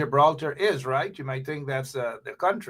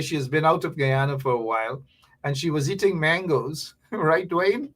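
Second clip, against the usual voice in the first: usual speaking voice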